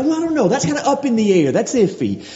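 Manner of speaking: high, unsure hedging voice; mocking a hesitant person; wishy-washy doubter. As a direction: play someone shrugging it off as iffy. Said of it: "Are you gonna, will you be able to do".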